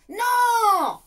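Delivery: loud, high, stubborn NO; grumpy boy shouting no; shouted no. Acted. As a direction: fling the refusal out like a sulking boy. Ned refuses his parents.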